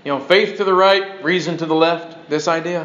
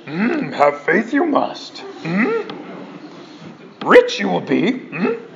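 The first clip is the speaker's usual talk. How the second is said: slow, inverted-word Yoda voice; Yoda impression; 2 semitones higher, much slower than usual. Preacher mocking the TV guru as Yoda, slow croak. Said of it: "Brother Yoda".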